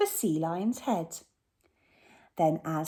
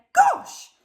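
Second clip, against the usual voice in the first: much higher, louder startled yelp; surprised exclamation; 7 dB louder than usual. Acted. Hugh falls into the water, high startled cry. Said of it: "and Len said".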